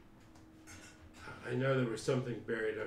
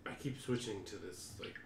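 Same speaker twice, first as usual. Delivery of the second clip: ordinary delivery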